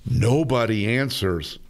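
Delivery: ordinary delivery, nothing marked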